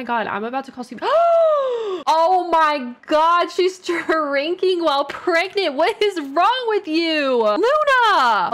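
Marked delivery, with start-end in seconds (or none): 1.0-2.0 loud, high gasp of horror; shocked gasp. Real, not acted. Sees pregnant Sim drinking liquor. 2.0-8.5 high, loud, slow scolding with a laugh breaking through; shocked yelling. Real, not acted. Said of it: "I just yelled at her".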